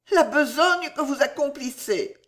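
high-pitched, pained woman's voice; guilty clarification. A narrator's reading. Woman naming his shameful work, pained and guilty. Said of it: "La tête basse, comme une coupable, elle précisa".

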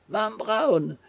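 high startled cry of alarm; choked cry. Acted. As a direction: cry out her name as she grabs you. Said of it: "s'écria le rémouleur". (cri étranglé et aigu)

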